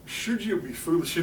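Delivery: ordinary delivery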